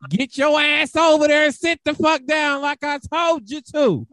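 put-on high furious shout at a kid; angry teacher yell. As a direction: bark it at a child who won't sit down. Acting out yelling at a child.

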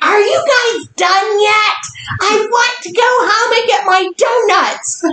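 high-pitched whining mom imitation; mock mom nagging to leave; impatient mom. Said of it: "And my mom's like".

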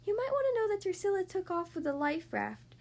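hesitant daughter. A narrator's reading. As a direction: tentatively break news to dad. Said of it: "she said".

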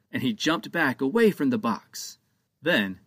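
ordinary delivery, nothing marked

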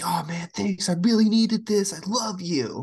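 high, gushing mock thanks; grateful gamer. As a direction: ham up a thrilled stranger thanking you. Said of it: "And they're like".

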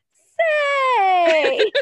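put-on high, loud drawn-out kid's exclamation; imitating her daughter. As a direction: belt it out like an excited child reciting. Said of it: "she'll look at me and she'll go".

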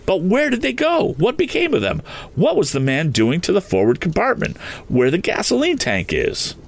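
high-pitched, urgent run of questions; almost shouting. A narrator's reading. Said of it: "Tom almost shouted".

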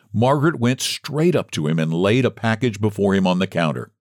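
plain reading voice

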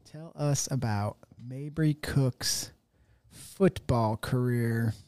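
slow, soft word-by-word dictation; quietly spelling out a typed query, phrase by phrase. Real, not acted. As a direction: say it slowly as you type. Dictating the prompt while typing it.

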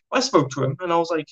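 ordinary delivery

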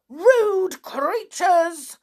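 high, slow indignant shout; angry shout. Acted. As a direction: snap at them like a cross pixie. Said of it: "shouted the angry little man".

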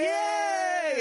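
high squealing yay; excited cheer. Pretend. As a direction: cheer like a thrilled kid. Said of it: "And you go like".

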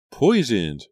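loud, high exclamation of shock; shocked utterance. A narrator's reading. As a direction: blurt the word out, aghast. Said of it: "Julia muttered".

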